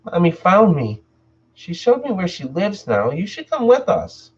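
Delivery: put-on high, strange ghostly child voice; eerie little girl. Ghost daughter inviting her father to join them, eerie high child voice. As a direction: lure him in sweetly like a ghost child. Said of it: "Sarah said".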